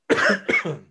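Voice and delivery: sudden real choking cough; coughing fit. Real, not acted. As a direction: cough up a stuck bite. Choked on mushrooms mid-podcast.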